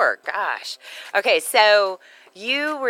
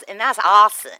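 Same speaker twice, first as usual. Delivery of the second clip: put-on loud, emphatic copy of her accented AWESOME; exaggerated mimicry of an accented speaker; Christine Kane; 3 semitones higher, 9 dB louder than usual. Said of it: "kinda like Christine ... how she goes".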